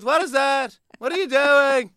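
high, far-off sitcom-comic voice, punchy questions; Jerry Seinfeld. Shown as a demonstration. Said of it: "I'm talking like Jerry Seinfeld".

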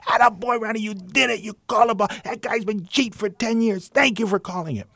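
high, loud excited gushing; jubilant congratulation. Retold or imitated. Manager thanking umpire for calling it, jubilant high yell. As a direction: gush with acted triumph and gratitude.

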